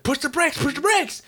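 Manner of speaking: high-pitched panicked command, rapid-fire; urgent, squealed backseat order; frantic urging. Retold or imitated. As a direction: imitate a panicking driving instructor, voice shooting up. Instructors urgently yelling during driving lesson.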